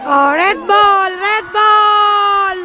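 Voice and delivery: long, stretched sideline call; slow, carrying team cheer; drawn-out cheer. Real, not acted. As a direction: stretch each word out slowly as a chant.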